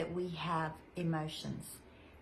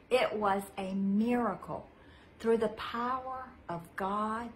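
excited; 3 semitones higher, 5 dB louder than usual. A narrator's reading. Storyteller to children, marvelling at the miracle. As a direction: announce the miracle with joy.

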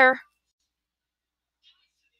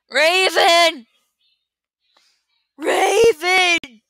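loud call, higher, carried to the next room; 7 semitones higher, 7 dB louder, much slower than usual. Real, not acted. Calling the cat Raven, loud call. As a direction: call the cat from far away.

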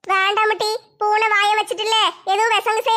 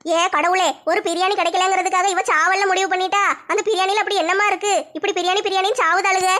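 normal talking voice

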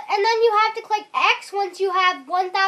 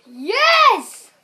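real gamer's victory yell; excited outburst; 2 semitones higher, 6 dB louder than usual. Real, not acted. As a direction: shout it in triumph. Found loot in game.

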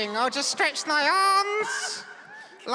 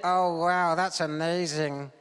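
deep dummy voice; 12 semitones lower than usual. Pretend.

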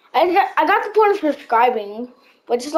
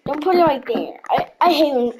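usual speaking voice

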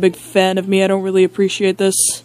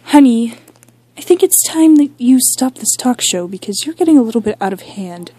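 higher, quick nagging spouse; concerned spouse voice; 4 semitones higher, 4 dB louder than usual. Acted. Spouse asking Dan to stop the talk show, high nagging. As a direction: put on a higher voice and gently scold.

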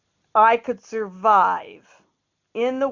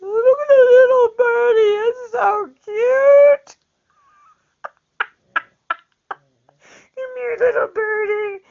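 put-on much higher sing-song baby talk to a birdie; cooing at a bird; 13 semitones higher, 6 dB louder than usual. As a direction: coo to the little bird like a baby. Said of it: "But I will talk, you know, like".

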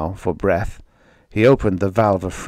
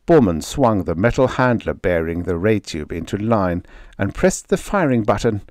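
usual speaking voice

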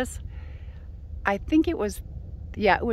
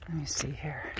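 whisper; 4 semitones lower, 10 dB quieter than usual. Real, not acted. Quietly checking the view.

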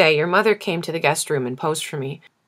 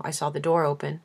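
usual speaking voice

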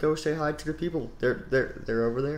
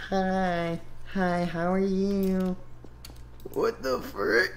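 higher, slow put-on cartoon voice; a copied voice, higher and slow; borrowed character voice; 6 semitones higher, much slower than usual. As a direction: drawl it out in someone else's silly voice. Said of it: "you can't use that voice".

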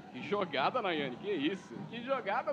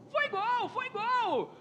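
higher, louder goal cry; excited goal cry; 6 semitones higher, 5 dB louder, much slower than usual. Real, not acted. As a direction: shout it's a goal.

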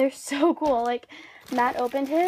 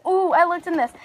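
excited; 6 semitones higher, 10 dB louder than usual. Real, not acted. Excited over eyelash box.

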